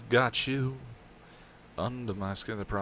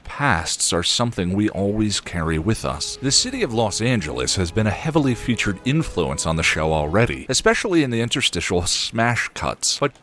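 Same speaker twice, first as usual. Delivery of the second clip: steady voiceover narration; narrator read; 8 dB louder than usual. Shown as a demonstration. Recording voiceover takes.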